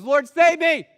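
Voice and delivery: loud, high cry for help; desperate cry. Acted. Peter sinking, crying out to Jesus, a loud desperate shout. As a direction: shout it out as if going under.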